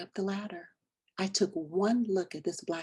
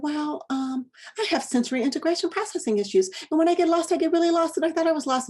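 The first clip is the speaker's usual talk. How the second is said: rushed, higher and louder nervous babble; flustered fast talk, explaining herself to the stranger; talking really fast; 9 semitones higher, 5 dB louder, faster than usual. Retold or imitated. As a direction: gabble it out in a fluster. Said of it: "started talking really fast".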